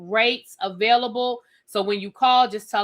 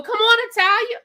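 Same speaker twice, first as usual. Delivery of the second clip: high, excited call to a viewer; a high greeting shout; 12 semitones higher, 4 dB louder than usual. Real, not acted. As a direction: sing out her name, pitched high. Calling out to a viewer.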